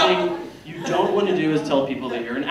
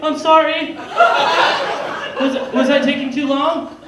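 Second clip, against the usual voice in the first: much higher and louder, flustered; panicked over-apologizing; anxious apology; 10 semitones higher, 5 dB louder than usual. Retold or imitated. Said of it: "And I'm like".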